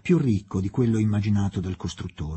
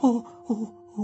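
startled, breathless oh's, pitched high; panting; 11 semitones higher, much slower than usual. Acted. Startled woman catching her breath in the dark, panting. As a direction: gasp them out as if caught off guard. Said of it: "fece la donna ansante".

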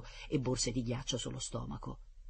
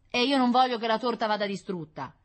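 put-on stubborn boy; 9 semitones higher, 7 dB louder than usual. Boy answers professor.

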